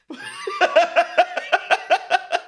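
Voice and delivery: high bubbling laugh; helpless cackling laugh; laughter. Real, not acted. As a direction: burst into a long giggle. Sound of the speaker's own laughter.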